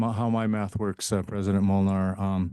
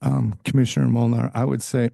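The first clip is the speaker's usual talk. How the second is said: hoarse, strained voice; losing voice; 3 semitones higher, 4 dB louder than usual. Real, not acted. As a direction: voice giving out, hoarse. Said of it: "I'm losing my voice".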